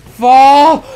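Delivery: a high, loud cry cut short; sudden cry. Real, not acted. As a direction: yelp as it goes wrong.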